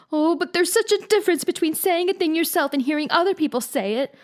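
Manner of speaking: put-on high, pleading girl's wail. A narrator's reading. Anne wailing to Marilla about being called ugly. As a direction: cry it out like a wronged child. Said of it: "wailed Anne".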